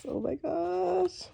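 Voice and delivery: soft, high, stretched-out squeal; excited squeal. Real, not acted. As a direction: squeal softly, slow and high with delight. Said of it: "this is so exciting".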